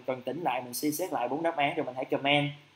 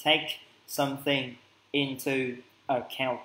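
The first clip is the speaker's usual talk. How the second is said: slow model phrase; 2 semitones lower, 4 dB quieter, slower than usual. Shown as a demonstration. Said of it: "mình hãy dùng cho thầy là account".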